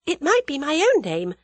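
high, composed woman's voice; cool young heroine. A narrator's reading. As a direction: point it out lightly, pitched high.

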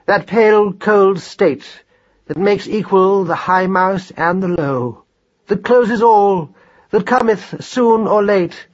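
grandiose, high-flown oration; pompous father's speech of gratitude; formal speech. A narrator's reading. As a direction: declaim slowly with swelling solemnity. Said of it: "deliver a short, formal speech".

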